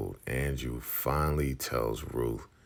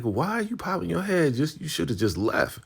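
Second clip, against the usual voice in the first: high, louder mock voice; exasperated viewer; 5 dB louder than usual. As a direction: scold in disbelief. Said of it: "I'm like".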